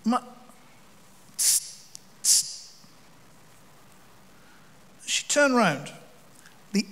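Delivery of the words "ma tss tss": soft unvoiced tss spray sounds; quiet mock spray hiss; imitated spray hiss.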